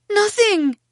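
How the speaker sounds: very high, loud blurt; caught-out denial. A narrator's reading. Belle denying it to angry Mother, caught out. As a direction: blurt it defensively in a high little voice.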